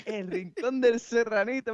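high, slow mock Japanese tourist voice. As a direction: deliver it as the deadpan tourist punchline. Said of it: "me dice el hijo de puta".

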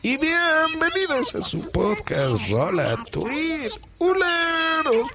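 very high, slow cartoon voice; squeaky high podcast host voice; high-pitched slow silly character. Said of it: "y hablo así".

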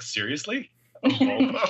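rising mock disbelief; incredulous dad. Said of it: "The dads are like".

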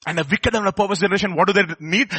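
usual speaking voice

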